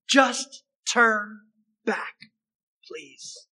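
a plea fading to soft on please; weary, let it sink to a soft please; frustrated plea. Retold or imitated. Naomi begging her daughters-in-law, tired and frustrated. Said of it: "sense the frustration in her words".

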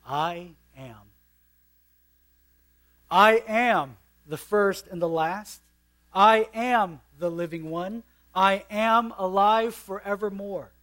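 preacher declaring Christ's words; preaching proclamation. Acted. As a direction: proclaim each I am with weight.

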